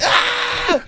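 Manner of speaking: high hammed-up scream; mock panicked scream. Storyteller acts out panic at the pistol, mock screaming. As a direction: scream as if a gun is pointed at you.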